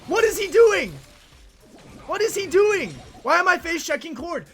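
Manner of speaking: high, incredulous wail; exasperated cry. Real, not acted. Player left alone by his teammate, exasperated high cry. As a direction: cry out in disbelief at a useless teammate.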